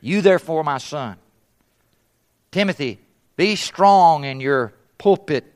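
usual speaking voice

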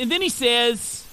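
ordinary talk, nothing marked

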